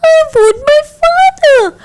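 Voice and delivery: put-on very high, quick child's shout; high frantic child's shout. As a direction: shut out everything and shout for his parents.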